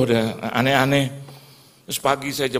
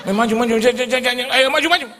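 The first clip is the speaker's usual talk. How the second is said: very high, loud rapid-fire urging; urgent urging; 11 semitones higher, 7 dB louder, faster than usual. Retold or imitated. Crowd insisting he go up front, high urgent shouting. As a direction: shout him forward, pitched right up.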